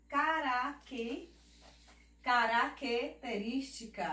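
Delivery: "característica" as "ca-ra-quê... te-rística"; "característica" split after the C sounded quê; syllable division. Shown as a demonstration. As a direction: stop after "caraque-", then finish característica. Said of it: "ele fica sempre na consoan".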